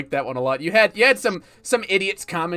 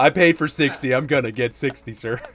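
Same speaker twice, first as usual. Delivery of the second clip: low, mock-polite dug-in voice; stubborn grinder; 5 semitones lower than usual. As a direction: repeat it smugly.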